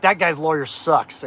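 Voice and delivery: slow, loud, dismissive verdict; drawn-out brash put-down; cocky lawyer. Retold or imitated. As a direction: scoff like a tough little lawyer. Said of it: "He had a major attitude".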